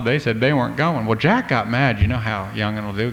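plain reading voice

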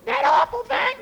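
high falsetto gasp of disgust; a prissy lady horrified; squeaky high queen recoiling from the kiss; appalled queen. Acted.

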